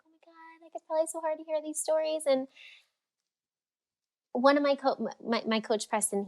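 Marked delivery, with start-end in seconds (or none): 0.1-2.2 higher, mock pitying gasp; a light mock of people's pity; imitating pitying people. Mimicking people who pity her for hearing stories. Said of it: "people are like".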